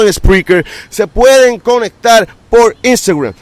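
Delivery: loud, fast hyped-up podcast outro; wrestling promo. Acted. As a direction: hype it up like a ring announcer. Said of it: "let me do my promo now".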